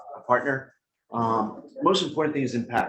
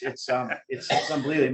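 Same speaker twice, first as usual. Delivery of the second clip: ordinary talk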